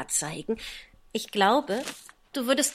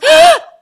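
loud high gasp of dismay; sharp gasp; 20 dB louder than usual. Acted. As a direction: gasp as you realize your blunder. Alice catches herself mid-sentence.

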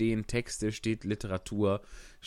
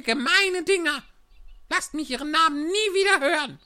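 high squeaky mouse voice, louder, spitting contempt; a tiny mouse ranting about cats; outraged mouse; 6 dB louder than usual. Acted.

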